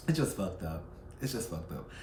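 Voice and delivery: low, soft disgusted mutter. Real, not acted. Vlogger muttering to viewers, fed up and soft. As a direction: mutter it under your breath, fed up.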